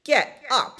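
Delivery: high, loud squealed command; pig squeal. A narrator's reading. Pig ordering the cow out of bed, high squeal. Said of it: "squealed Pig".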